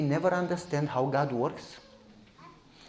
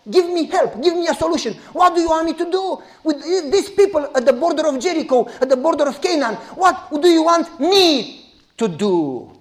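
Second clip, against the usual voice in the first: high loud urgent plea; pleading Joshua; 7 semitones higher, 5 dB louder than usual. Acted. Joshua pleads with God about Jericho, loud. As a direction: beg for a solution.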